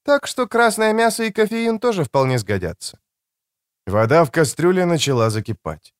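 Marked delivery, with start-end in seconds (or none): 0.1-3.0 high-pitched voice for a woman; higher female character voice; woman's voice. A narrator's reading.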